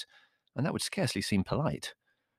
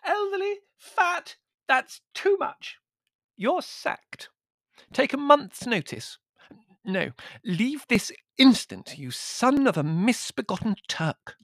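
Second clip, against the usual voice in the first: very high hysterical countess; screaming outrage; 3 dB louder, slower than usual. A narrator's reading. Countess sacking her servant, shrieking fury. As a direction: shriek indignantly, drawing out the key words. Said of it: "she screamed".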